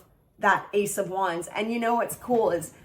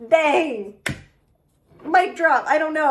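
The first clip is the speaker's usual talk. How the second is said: excited gasp; 7 semitones higher, 6 dB louder than usual. Real, not acted. Reacting to perfect reading.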